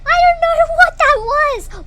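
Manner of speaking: high, loud, drawn-out bewildered wail; rattled outburst. Real, not acted. Player shaken by the strange figure, wailing in alarm. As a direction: shout it, shaken from a fright.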